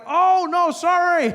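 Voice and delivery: loud, high mock apology; flustered, high-pitched backtracking; over-apologetic. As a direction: gush a hammed-up apology.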